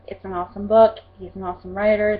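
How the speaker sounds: ordinary talk, nothing marked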